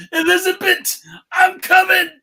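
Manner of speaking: high, loud gravelly wrestling promo; Macho Man voice. Retold or imitated. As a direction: roar it like a wrestler. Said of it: "I will do Macho Man".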